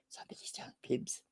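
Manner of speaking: soft teasing whisper. Real, not acted. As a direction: whisper it like a secret aside. Playful aside to chat.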